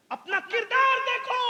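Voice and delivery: high-pitched drawn-up cry; impassioned exhortation. Real, not acted. Preacher telling listeners to examine themselves, high urgent exhortation. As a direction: urge them with a high call.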